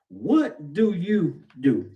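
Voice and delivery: very slow, plain-pitched challenge; a preacher putting the question to his church, slow and earnest. Shown as a demonstration. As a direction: lay each word down slowly to the congregation. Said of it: "I'm asking you the question tonight".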